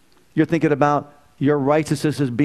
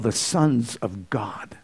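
hushed, breathy scripture line; whispered; 4 semitones lower, 5 dB quieter, much slower than usual. Real, not acted. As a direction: let it drop to a whisper.

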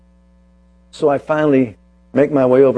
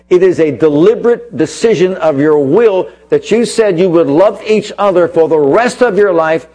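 raised, emphatic sermon voice, punching key words; preaching; 4 semitones higher, 4 dB louder than usual. Acted. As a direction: drive the point home to the congregation. Preacher counsels couples.

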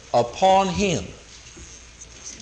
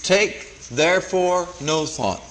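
"Take therefore no thought" slowly, each word set apart; slow, spaced-out emphasis on every word of "Take therefore no thought"; underlined phrase; slower than usual. Shown as a demonstration. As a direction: underline "Take therefore no thought" with deliberate pauses between words. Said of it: "Again, underline this phrase".

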